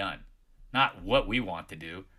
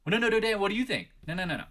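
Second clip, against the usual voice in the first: high, quick mock protest; mock protesting member; 9 semitones higher, faster than usual. Streamer imitating a member dodging his question, high mock voice. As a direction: squeak out a flustered denial. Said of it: "mimicking member's reply".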